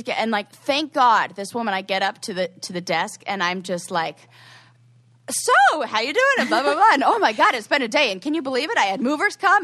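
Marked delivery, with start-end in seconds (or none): 5.3-9.6 high, loud forced small talk; acting out over-friendly distracting babble; nervous chatter. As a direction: chatter on breezily so nobody can interrupt. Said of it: "talking and talking".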